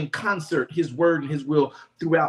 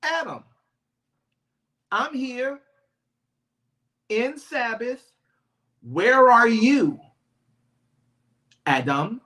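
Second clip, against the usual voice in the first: God calling Adam; 5 semitones higher, 3 dB louder than usual. Acted.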